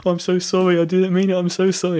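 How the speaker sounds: bully hugging him, high loud pleading sorry; sobbing apology. Retold or imitated. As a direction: beg forgiveness, overwrought. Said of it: "He's like".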